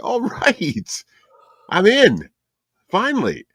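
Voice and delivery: high-pitched retold cheer; retold excitement. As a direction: act out your own delighted reaction. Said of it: "I was like".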